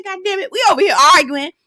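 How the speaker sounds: high, fast exasperated outburst. Retold or imitated. Her imagined outburst at William, high and fast. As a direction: throw up your hands at the chaos. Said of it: "I said".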